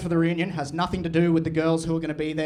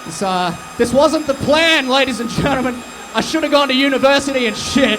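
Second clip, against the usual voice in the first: much louder, higher shouted patter; shouting over crowd; 6 semitones higher, 8 dB louder than usual. Real, not acted. Comic to crowd during chaos, loud high shouted patter. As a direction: project over the roaring crowd.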